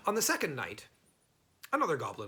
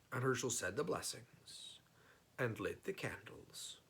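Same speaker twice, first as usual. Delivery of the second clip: plain reading voice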